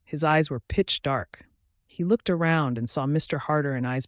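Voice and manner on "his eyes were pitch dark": usual speaking voice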